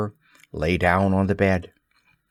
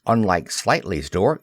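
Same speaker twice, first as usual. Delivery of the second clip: ordinary delivery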